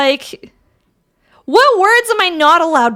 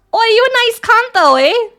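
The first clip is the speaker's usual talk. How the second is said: lightly put-on, higher Australian accent; 6 semitones higher than usual. Streamer testing a swear on chat, mock Australian accent. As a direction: try it in a playful Aussie accent. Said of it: "If I say it in an Australian accent".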